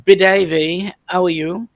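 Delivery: lightly put-on French accent, playful; mock French accent. Host greeting a viewer in a jokey French accent. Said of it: "I'm speaking with my best French accent".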